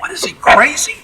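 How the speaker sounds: high, loud mock disbelief; dumbstruck outrage. Boeing executives aghast at their stunt-flying pilot, dumbstruck. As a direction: act out an appalled executive, voice shooting up. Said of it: "The Boeing executives are dumbstruck".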